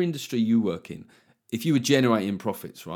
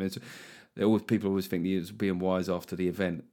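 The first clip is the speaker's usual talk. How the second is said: normal talking voice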